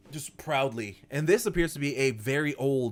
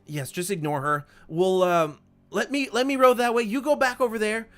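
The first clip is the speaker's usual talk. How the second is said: a touch higher, louder brush-off of the wife; hurried old gnome; 6 semitones higher, 4 dB louder than usual. Acted. Gimbal brushing off his wife to the creature, hurried and louder. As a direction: wave it away and get rowing. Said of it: "And he's like".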